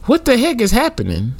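high, stunned question; baffled at the scene; shocked. Retold or imitated. Retold shocked thought.

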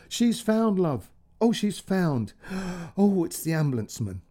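lighter, relieved. Acted. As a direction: burst out with joy that she's found. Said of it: "suddenly Mum's voice changed, suddenly, lighter".

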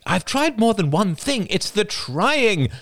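high, loud protesting cry; cried out. Acted. Son crying out in frustration at his mother. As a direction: cry it out, slowing at the end. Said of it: "cried Oliver".

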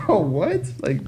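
high-pitched, halting incredulity; retold disbelief. Replying to a rival's threatening messages, retold high bewilderment. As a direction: stumble over the question in mock bewilderment. Retelling his reaction to threats.

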